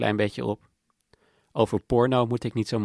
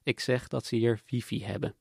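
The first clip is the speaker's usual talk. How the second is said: usual speaking voice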